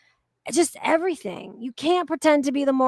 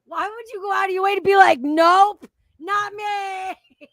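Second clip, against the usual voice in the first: higher, louder laughing fake outrage; mock outrage; 7 semitones higher, 5 dB louder than usual. Real, not acted. Teasing the co-host for his reply, mock outraged shout. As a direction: pretend to be scandalized, laughing.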